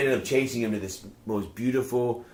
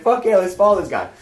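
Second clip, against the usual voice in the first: excited; 8 semitones higher, 6 dB louder than usual. Retold or imitated. Retold eager reply to chase.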